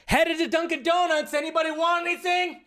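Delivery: high, strained calling-out voice; put-on character voice. Figure in the shot offering a donut run, high put-on call.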